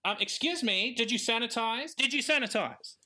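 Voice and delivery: high, rising anxious yell, building up; a panicky shopkeeper demanding answers; David, anxious yelling. Retold or imitated. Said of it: "be yelling".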